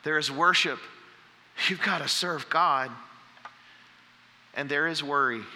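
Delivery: ordinary talk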